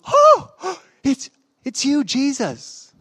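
a high, breathless gasp of recognition; Mary suddenly realizing who he is; astonished gasp. Acted. Mary recognizes the risen Jesus.

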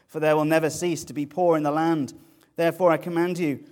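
loud fast proclamation of scripture; proclaiming loudly. Acted. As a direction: declare it like a divine command. Quoting God's command in scripture.

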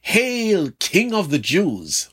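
mocking derision. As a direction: sneer it like a mocking soldier. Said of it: "calling in utter derision".

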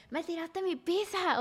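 imitando al novio, agudo y lento, quejándose; novio quejumbroso. Retold or imitated. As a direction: se burla del ex que solo piensa en su pizza. (el ex reclamando por su pizza, voz de burla un poco más aguda)

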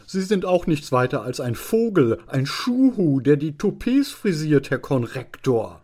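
high, loud, mocking tirade; insulting student. Drunken student insulting the deputy headmaster, loudly mocking. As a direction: hurl it as an insolent student.

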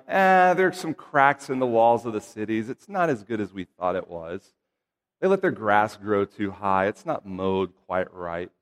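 low, subdued mock complaint; unimpressed nitpicking. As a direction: grumble mildly like a fussy inspector. Mock lukewarm report, HOA-style nitpicking.

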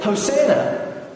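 high, stretched mock cry of joy; emphatic acclamation. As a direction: proclaim it high, drawing it out. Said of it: "And it was emphatic".

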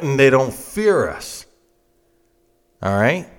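normal talking voice

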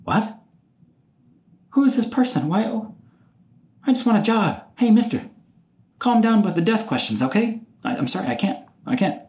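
high-pitched mock job candidate, thrown by the question; put-on high voice of flustered protest; bewildered interviewee. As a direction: imitate a startled interviewee pleading to be let off. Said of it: "'cause they're like".

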